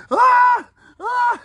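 high-pitched yelp, loudly imitated; mock pained scream. Friend acting out a man bitten mid-sex, hammed-up scream. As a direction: yelp high, hamming up sudden pain. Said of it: "and you're like".

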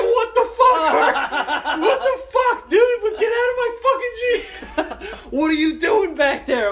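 very high, loud outraged shout; hammed-up shock, laughter creeping in; retold startled yelling. Startled driver yelling at stowaway.

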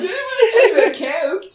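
high, loud cartoon squeal; mock them in a shrill South Park voice. Mocking co-host over the blue stuff, South Park voice. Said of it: "Are you doing a South Park voice?".

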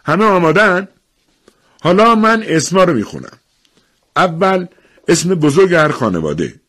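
high, loud announcing voice; official addressing a crowd. Acted. Official explaining the draw to townsfolk, projecting. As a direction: project the rules to everyone assembled.

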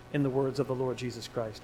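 usual speaking voice

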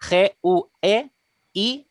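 letters spelled out slowly; deletreando. Shown as a demonstration. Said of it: "tal y como lo escribiríamos". (deletreando muy despacio letra a letra)

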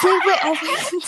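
laughing, high and loud. Real, not acted. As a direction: laugh through the words. Laughing protest.

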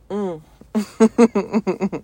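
a long loud mm; loud hum. Real, not acted. Loud hum after chill.

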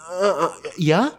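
usual speaking voice

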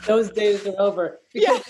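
laughing. Real, not acted. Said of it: "host laughing along".

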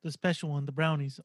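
a rough stab at an English accent; low, badly faked English accent. Shown as a demonstration. Co-host ribbing Special K, bad English accent. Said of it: "That was the worst English accent".

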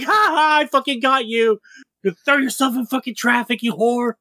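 high, laughing hammed-up bravado; mock tough-guy Light. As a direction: ham up a cocky punk sure he can win. Voicing Light going apeshit.

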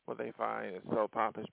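usual speaking voice

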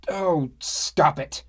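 loud, high, slow scolding; angry rebuke. Acted. Sumi scolding the rowdy dads, loud and angry. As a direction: snap it at the table of louts.

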